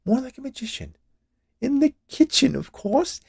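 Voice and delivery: high, playful woman's voice; coy woman. A narrator's reading. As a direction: tease it sweetly up high. Witch joking coyly.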